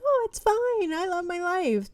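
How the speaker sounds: sing-song fake cheer, mocking it; high, sugary mock of forced positivity. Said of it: "this fake fucking positivity shit".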